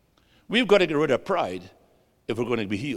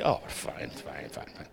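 resigned whispered fine; whispering; 13 semitones lower, 13 dB quieter, slower than usual. Real, not acted. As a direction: whisper it, giving in. Gives in to time bidding.

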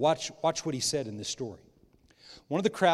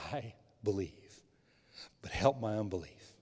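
tearful confession; 4 semitones lower, 5 dB quieter than usual. Acted. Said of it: "said with tears".

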